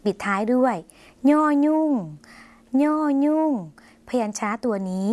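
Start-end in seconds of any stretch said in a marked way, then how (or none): 1.3-3.5 ย่อยุ่ง with a nasal ny sound, pitched higher; nasal Lao nyo. Shown as a demonstration. Teacher shows viewers the nasal ย่อยุ่ง sound, pitched up. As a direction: say ย่อยุ่ง starting through the nose, like northern Thai. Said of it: "ออกเสียงเน่อ เป็นเสียงขึ้นจมูก".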